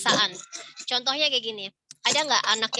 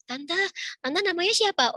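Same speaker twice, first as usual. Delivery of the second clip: put-on curious child; 7 semitones higher than usual. Child approaches at hotel pool.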